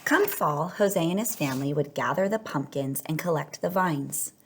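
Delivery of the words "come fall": ordinary delivery, nothing marked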